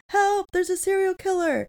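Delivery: mock scream. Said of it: "he starts screaming with her".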